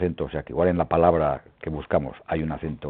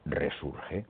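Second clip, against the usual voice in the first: 1 soft answer; 3 semitones lower, 12 dB quieter than usual. Shown as a demonstration. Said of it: "vuelvo a deciros".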